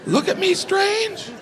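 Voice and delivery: very high, fretting voice; high panicked worry. Retold or imitated. Comic doubting his own pronunciation, high anxious fretting. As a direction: spiral into paranoid doubt.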